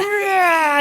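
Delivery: high, loud playful roar; monster roar. Pretend. A mother playing monster chasing her kids, loud roar. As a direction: pounce with a big monster growl. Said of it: "I'm like".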